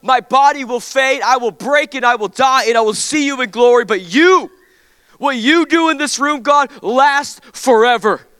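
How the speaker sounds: high, loud, rising passionate prayer; impassioned pastor's prayer; fervent prayer. Acted. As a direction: pray it up to a preaching peak. Pastor praying at full force.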